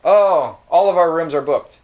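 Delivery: just blurt out the bad news; blunt clerk, no softening; blunt announcement. Shown as a demonstration. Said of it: "he could".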